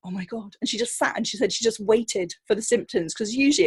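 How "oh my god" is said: stunned hush. Retold or imitated. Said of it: "And she was like".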